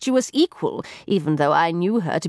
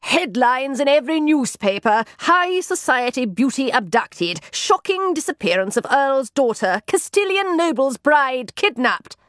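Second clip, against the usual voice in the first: irate, higher-pitched declaiming; raised in ire; 5 semitones higher than usual. A narrator's reading. Sherlock downstairs reciting newspaper headlines, irate. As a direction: rattle off headlines in indignation. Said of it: "a voice raised in ire".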